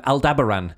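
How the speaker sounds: Aldebaran as al-DEB-er-an; narrator's pronunciation. Retold or imitated. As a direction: stress the second syllable of Aldebaran. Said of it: "he calls it".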